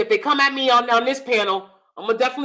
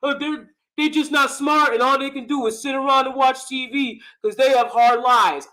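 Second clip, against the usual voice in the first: higher, slightly louder put-on voice, dragging the end; mocking imitation; 5 semitones higher, 3 dB louder than usual. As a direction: caricature him as patronizing and dumb-sounding. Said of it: "have it seem like".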